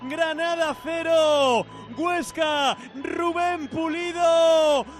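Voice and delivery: slow, drawn-out score; scoreline call. Real, not acted. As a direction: stretch each name of the score. Scoreline announcement.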